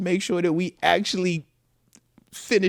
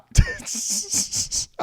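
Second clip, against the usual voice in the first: airy laugh; 4 dB quieter than usual. Real, not acted. Laughing at son's jersey choice.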